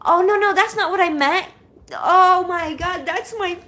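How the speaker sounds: high, loud, stunned cry of disbelief; can't believe what she sees; overwhelmed surprise. Real, not acted. Shocked by surprise partner message.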